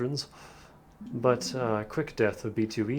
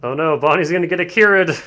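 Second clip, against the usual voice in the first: excited alarm; 4 semitones higher, 12 dB louder than usual. Real, not acted. Gamer reacts to scene.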